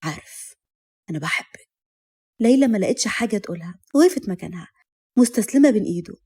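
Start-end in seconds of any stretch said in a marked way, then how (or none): 0.0-1.7 low, very soft murmur; tender confession. A narrator's reading. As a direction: confess love softly, pitched down. Love confession voiced in story.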